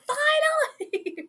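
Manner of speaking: very high, drawn-out cry of relief; long, elated high wail; jubilant squeal. Real, not acted. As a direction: stretch it slowly in a high squeal. Celebrating that Kirk is gone.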